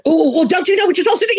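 high, fast, pushy mock boss voice; mock aggressive boss. Boss under pressure pushing her team, high fast mock aggression. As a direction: imitate her old forceful self, sharp and quick. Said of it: "I become more aggressive and forceful".

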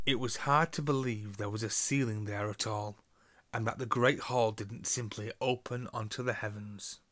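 ordinary delivery

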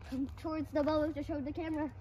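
ordinary talk, nothing marked

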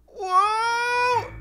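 long high yell of being flung; high whoa cry. Pretend. Player being sneezed out, yelling a long high whoa. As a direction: cry out as you're hurled backwards. Shot backwards out of shark.

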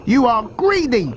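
high-pitched little-croc voice; high, insistent character voice; not-so-big crocodile, disapproving. A narrator's reading. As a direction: protest way up high, as a small croc. Smaller crocodile scolds.

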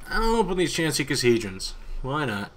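ordinary delivery

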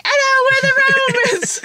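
high squealed mock-Roman accent, drawn out. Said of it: "their accent could have sounded like".

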